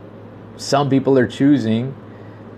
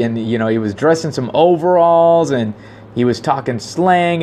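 usual speaking voice

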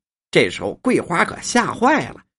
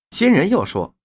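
plain reading voice

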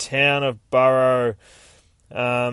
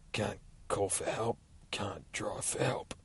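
slow, menacing whisper; creepy whisper; 11 dB quieter, slower than usual. Acted. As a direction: whisper it like a taunting prisoner. Creepy prisoner voice.

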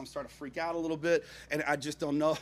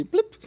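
high, sudden little blip; mimicked lock sound, high and short; imitated door-lock blip; 10 dB louder than usual. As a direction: pop out a loud squeaky blip. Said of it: "one of those ones where you're like".

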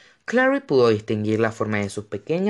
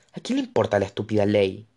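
ordinary delivery, nothing marked

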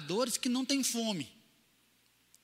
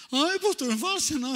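high, loud imitated mother's plea; mock protest; 7 semitones higher, 7 dB louder, faster than usual. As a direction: exclaim it like a scandalized mother. Mãe imitada protesta com pastor. (protesto agudo, imitando mãe)

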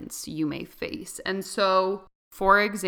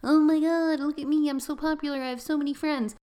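put-on higher, bragging popular-girl voice; mock bragging; 8 semitones higher than usual. Mocking herself as the popular girl bragging about friends, higher. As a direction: lightly show off like a smug popular girl. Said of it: "I'm not bragging like".

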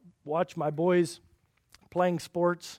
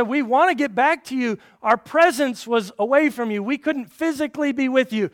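loud, high fervent preaching of Paul's words; impassioned preaching; 8 semitones higher, 9 dB louder than usual. Acted. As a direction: proclaim it with full force. Preacher voices Paul's longing.

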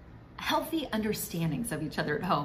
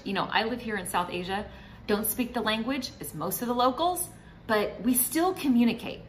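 usual speaking voice

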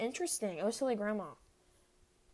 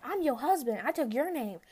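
cocky fan; 5 semitones higher, 6 dB louder than usual. Retold or imitated. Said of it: "The dude was like".

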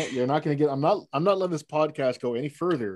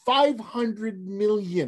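slow, higher, drawing out Five hundred million; stunned disbelief; 6 semitones higher, much slower than usual. Real, not acted. Host stunned by blackbird numbers, slow amazed repeat. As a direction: let the number sink in slowly.

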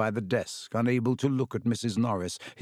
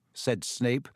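ordinary delivery

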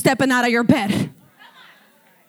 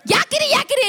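high-pitched creature jabber; squeaky creature; 10 semitones higher, faster than usual. Retold or imitated. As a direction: squeal it like a pestering critter. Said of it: "making this ugly, squeaky".

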